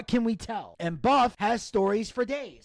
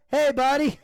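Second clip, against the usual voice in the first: bright, raised casual hello; breezy and friendly, as if nothing happened; cheery greeting; 5 semitones higher, 5 dB louder than usual. Retold or imitated. Buff hangs up and greets him, breezy raised hello. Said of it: "just like you normally would".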